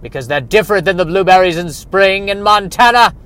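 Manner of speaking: high, booming mock-heroic proclamation; loud, overblown vow to the world; impassioned declaration. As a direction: shout it grandly, stretching the big words. Mock-heroic declaration in absurd story.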